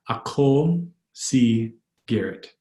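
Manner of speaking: measured words with pauses; slow, word by word. Shown as a demonstration. Teacher modelling "I am" in Tagalog, slowly word by word. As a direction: space out "Ako si Garrett" for a learner.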